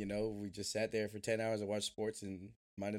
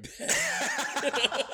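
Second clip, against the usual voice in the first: laughing mock quote; 5 dB louder than usual. Voicing friend, laughing.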